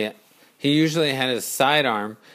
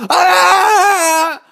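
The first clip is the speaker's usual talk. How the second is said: high loud panicked scream; crowd scream; 10 dB louder than usual. Acted. A rush of people screams past Courage. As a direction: scream as you stampede.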